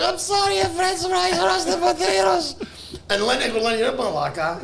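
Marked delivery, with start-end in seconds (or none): 0.0-2.6 put-on very high accented waitress; apologetic Greek waitress. Waitress saying sorry to the table, high mock-Greek voice. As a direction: plead it in a high, drawn-out put-on voice.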